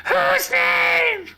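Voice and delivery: very high shrieked panic; screaming creature. Acted. Creature screaming back at its interrogator, high-pitched panic. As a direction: shriek it out like a tiny tortured beast. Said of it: "It screams and it goes".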